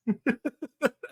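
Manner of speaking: high mocking cackle; gloating laugh. Real, not acted. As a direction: laugh down at the weirdos. Gloating over commenters.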